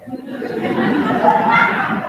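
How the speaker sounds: loud laugh. Real, not acted. Band director laughs at his own bad British accent.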